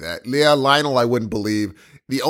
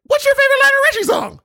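much higher, fast falsetto question; squeaky mock voice; 5 dB louder, faster than usual. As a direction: ask it again in a silly squeaky voice. Same question redone in put-on voice.

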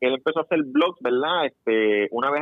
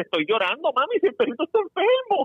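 mock upset child; 12 semitones higher than usual. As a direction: pitch climbing high as the crying boy. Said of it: "el nene le dice".

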